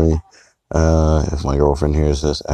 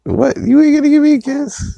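whiny high voice. Acted. Pleading for a kiss.

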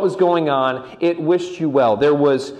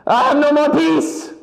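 high-pitched, hammed-up wail of despair; overblown, high-pitched meltdown at God; panicked cry to God; 3 dB louder than usual. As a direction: cry out in mock desperation, voice shooting way up. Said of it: "then one thing goes wrong".

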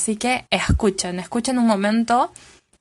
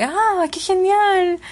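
much higher, slow, put-on voice of delight; delighted local; 9 semitones higher, slower than usual. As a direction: gush it like a charmed native, stretched out. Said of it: "otras se quedan como que".

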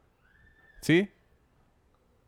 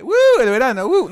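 high, loud, excited "Uh"; excited summer cry; 9 semitones higher, 8 dB louder than usual. Retold or imitated. Mimicking summer fans greeting the season. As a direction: whoop like a summer fan. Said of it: "lo primero que dice".

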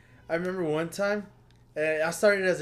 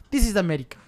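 put-on Middle Eastern accent; 3 semitones higher, much faster than usual. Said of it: "a Middle Eastern accent".

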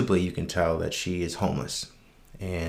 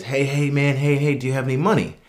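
calling out; 6 semitones higher, 4 dB louder than usual. Retold or imitated. Man on bike calling after narrator.

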